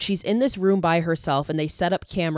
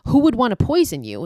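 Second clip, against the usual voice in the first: put-on raised, higher anguished call to the children; urgent plea; 6 semitones higher, 6 dB louder than usual. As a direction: call out to them, louder and higher. Medium pleading with dying ghost children.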